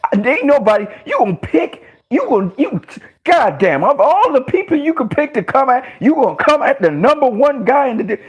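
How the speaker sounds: worked-up ranting; hyped. Real, not acted. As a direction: rant with disbelief and hype. Hyped rant about Pacquiao.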